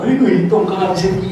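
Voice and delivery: a light loving scold to his daughter; fond mock curse; doting scold. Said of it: "이러고 뽀뽀하고". (딸에게 가볍게 하는 다정한 꾸중)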